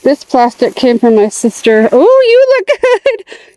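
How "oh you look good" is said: high delighted gush. Real, not acted. Admiring a plant.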